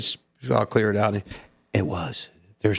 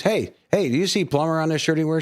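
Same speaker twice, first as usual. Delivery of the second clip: quick, pitched-up mock indignation; indignant husband; 6 semitones higher, faster than usual. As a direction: rattle it off like an irritated husband in a joke. Husband rebuffs wife in joke.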